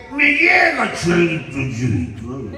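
put-on drunk heckler. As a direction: imitate a drunk yelling at the preacher. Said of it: "yo que comenzaba a predicar y aquel".

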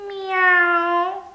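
high, loud yowling meow like a siren. A narrator's reading. Kitten yowling loudly at the family, siren-like meow. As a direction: yowl it like an air-raid alarm. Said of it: "wrzasnął kotek głośno jak alarm przeciwlotniczy".

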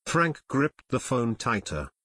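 plain reading voice

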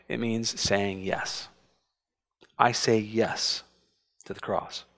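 slow, soft, almost whispered; solemn hush. Real, not acted. Preacher to congregation on taking up the cross, slow and hushed. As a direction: let it sink in quietly.

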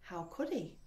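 ordinary delivery, nothing marked

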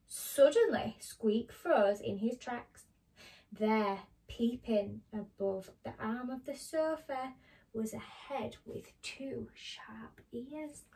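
animated picture-book reading; story narration to children. A narrator's reading. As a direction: read it to small children with careful, dramatic phrasing.

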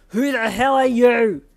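slow, booming, furious demand; livid holler. A narrator's reading. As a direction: holler it in rage at an intruder. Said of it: "the livid male hollered".

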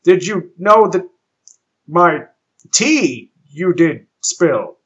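loud, exasperated captain. Acted. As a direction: demand it in disbelief. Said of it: "he asked".

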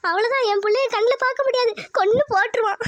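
high-pitched lament breaking into a sob; despairing wail. Acted. Mother fears kidnapper will kill her daughter, wailing. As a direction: wail it out and collapse into sobs.

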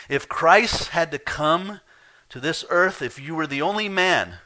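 rising pulpit passion; impassioned preaching. Real, not acted. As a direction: lift the congregation with fervour. Preaching climax.

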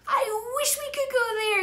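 high, loud, earnest wish; boy wishing. A narrator's reading. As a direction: make the wish with feeling. The wish that starts the magic.

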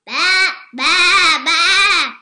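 loud high bleat; bleating sheep. A narrator's reading. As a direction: baa like a sheep. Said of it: "bleated Sheep".

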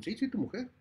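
high eager insisting; eager confirmation. Acted. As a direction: nod it through with a squeaky rush. Servant confirming the wife.

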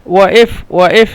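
"ওয়াইফ" broken by a glottal catch in the middle; doubled hamza. Shown as a demonstration. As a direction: hold a silent catch inside "ওয়াইফ" where qaf would be. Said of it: "কিন্তু হামজা তো".

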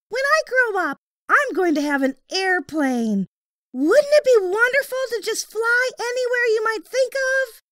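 put-on high, eager young boy; a young boy's high voice; little boy voice. A narrator's reading. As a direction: voice the small boy kindly. Boy's voice in story.

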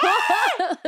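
high laughing yelp of fright; laughing fake plane scream; mock scream on a plane. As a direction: squeal like a scared flyer, laughing. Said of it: "yo grito".

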